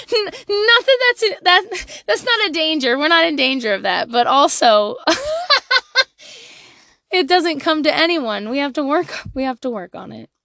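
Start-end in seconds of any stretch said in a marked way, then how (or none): 5.0-6.0 high burst of real laughter. Real, not acted. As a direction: laugh out loud at herself. Laughing at herself.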